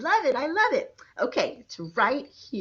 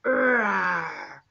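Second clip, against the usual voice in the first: lion roar; 4 semitones lower than usual. Shown as a demonstration. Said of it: "What does a lion say".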